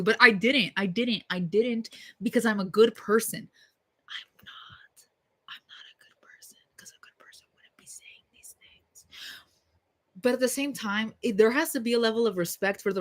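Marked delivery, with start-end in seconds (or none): 4.1-9.1 much softer whispered confession. Real, not acted. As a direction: whisper it to yourself like a guilty secret. Self-confession aside.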